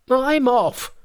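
very high, loud parting cry; loud, high-pitched monkey outburst; enraged Monkey. A narrator's reading. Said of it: "His silence enraged Monkey even further".

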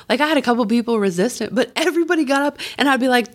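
usual speaking voice

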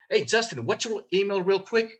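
friendly offhand sales tonality; no big deal. Shown as a demonstration. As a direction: toss off the question lightly. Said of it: "Not a big deal".

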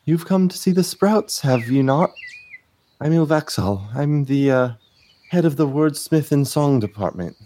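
high, lilting dryad professor voice; a melodic forest spirit greeting students; sing-songy dryad. Acted. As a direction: let the lines float up and down like a song. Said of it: "almost in a sing-songy voice says".

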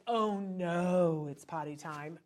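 the wrong way, a mopey groan; glum dread. Shown as a demonstration. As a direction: sound like potty time is a chore. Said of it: "It's not like".

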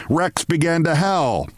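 usual speaking voice

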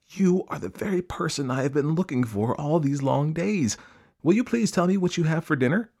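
put-on high, eager young elephant; breathless child. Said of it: "grew all breathless and panted".

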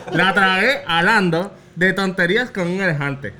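lipless words with a touch of laughter; slurred p, b and f; garbled mouth-game speech. Real, not acted. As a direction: get the phrase out with lips held open. Mouth game, partner guesses distorted phrase.

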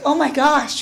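loud, high mock swoon; playful starstruck exclamation; retold amazement. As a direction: gush it out on the tail of a laugh. Retold amazement, just after laughing.

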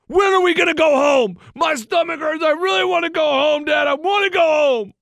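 high, insistent mock kid wail; whining five-year-old. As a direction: imitate a child nagging dad to leave. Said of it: "he lays down for about two minutes".

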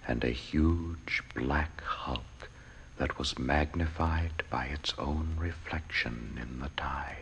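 low breathy whisper, slowing down; hushed whisper. A narrator's reading. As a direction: whisper it like a dark secret. Dramatic hush in poem reading.

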